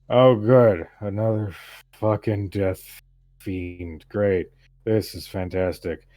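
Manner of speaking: loud opening, then sour mock delight; drunken sarcasm. Drunk man spots another death follower, sarcastic drunken scorn. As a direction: greet the newcomer with drunken scorn. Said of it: "He's got a bottle of alcohol".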